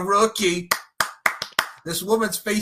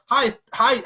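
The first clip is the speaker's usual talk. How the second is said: fake friendly greeting; 6 semitones higher, 5 dB louder than usual. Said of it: "acting the fact that".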